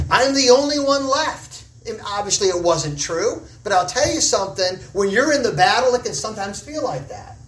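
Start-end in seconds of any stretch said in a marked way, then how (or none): 0.1-1.7 mild mock cry of despair, raised high; voicing Elijah's lonely lament, lightly. Preacher voicing Elijah's cry to the congregation, high and pleading. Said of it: "he cried out".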